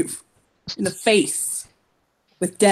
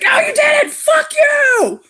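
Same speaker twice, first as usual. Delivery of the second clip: much higher, louder shout; furious outburst; 5 dB louder, much slower than usual. Retold or imitated. A character blowing up as his rival names a mate, furious shout. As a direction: explode at the announcement, full yell. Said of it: "He's like".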